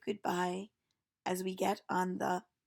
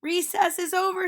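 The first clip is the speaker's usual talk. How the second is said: higher, louder call to the class; playful teacher yell, louder and higher; 9 semitones higher, 8 dB louder than usual. Acted. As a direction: call the children in from recess. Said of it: "she yells".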